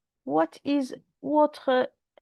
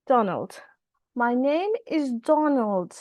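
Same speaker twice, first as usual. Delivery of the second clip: ordinary delivery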